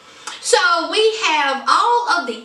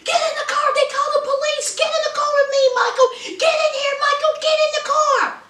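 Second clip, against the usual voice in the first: screeching at her man to get in; frantic high-pitched begging; mock Sarah; 14 semitones higher, much faster than usual. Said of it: "here's Sarah".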